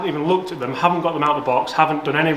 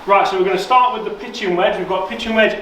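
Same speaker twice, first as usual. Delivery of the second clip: presenter voice; 5 semitones higher than usual. Acted. Presenter to camera.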